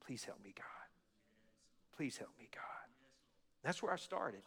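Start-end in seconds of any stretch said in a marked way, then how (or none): 0.1-2.6 hushed, unvoiced plea to God; whispered prayer. Real, not acted.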